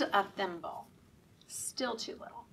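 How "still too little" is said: whispered punchline. Acted. Reader whispering the refrain to the kids. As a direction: breathe it softly, almost voiceless.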